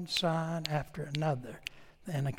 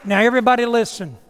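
loud, higher call for attention; 5 semitones higher, 11 dB louder, faster than usual. Real, not acted. Preacher calling the congregation to listen, loud. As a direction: call the room to order.

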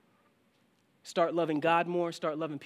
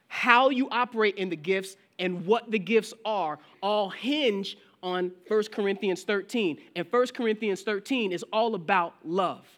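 rising, louder preacher's build; preaching; 4 semitones higher, 5 dB louder than usual. Acted. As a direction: drive the point home to the congregation. Pastor builds to preaching force.

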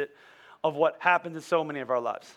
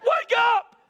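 high, panicked shout to wake someone; hammed-up cry of alarm, pitch leaping way up; freaking out; 4 dB louder, much slower than usual. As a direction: yell it like a terrified disciple. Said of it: "They're freaking out".

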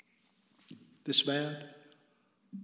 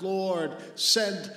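pleading cry, higher and louder; 5 semitones higher, 5 dB louder than usual. Acted. Preacher voices rich man begging from torment, crying out. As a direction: cry out for relief, building to the last word. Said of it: "he cries out".

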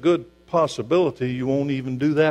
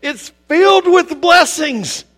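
high, loud, rousing pulpit delivery; preaching at full force; 12 semitones higher, 6 dB louder than usual. Real, not acted. Preacher urging the congregation to read Revelation, preaching at full force. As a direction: build to a high, punchy proclamation.

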